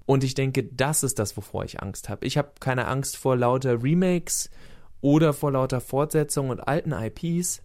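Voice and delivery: ordinary talk, nothing marked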